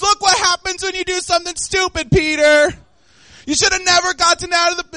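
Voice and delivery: high, loud, sneering rebuke; mock scolding Jesus. Mock Jesus mocking sinking Peter, high and scornful. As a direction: scold him like a fed-up teacher.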